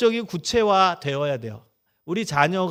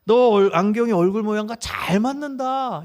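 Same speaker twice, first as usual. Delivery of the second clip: louder, higher delighted wow; dad admiring his son's new glasses, excited; enthusiastic praise; 4 semitones higher, 4 dB louder than usual. Shown as a demonstration. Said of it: "인정해 주는 거예요".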